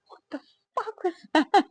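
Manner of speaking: high, stunned retelling of her reaction; half laughing, half horrified; shocked disbelief. Retold or imitated. Retelling upset after birth.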